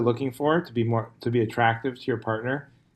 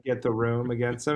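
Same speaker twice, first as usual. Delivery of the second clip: ordinary talk, nothing marked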